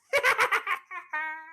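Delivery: high witch's cackle; high gleeful witch laugh. Acted. Witch laughs after prophecy.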